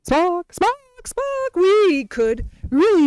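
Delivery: high, urgent calling of a name. Pretend. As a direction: summon him like a lost friend. Calling out for Spock.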